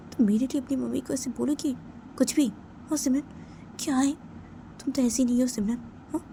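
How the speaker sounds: high-pitched disbelieving questions; upset, incredulous friend; dismayed protest. Acted. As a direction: plead with her, voice lifted high. Shocked protest at friend's threat.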